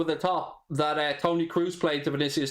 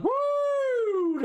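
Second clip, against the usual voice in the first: high whooping cheer; excited woo. Real, not acted. Cheering in a good mood.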